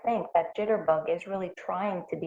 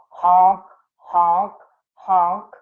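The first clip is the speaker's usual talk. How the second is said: honk, honk, honk, called out loud; mock goose honking; 8 dB louder, much slower than usual. Bird character honking.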